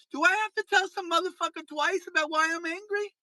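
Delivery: fed-up rant; angry outburst. Real, not acted. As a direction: lose patience with the chatter.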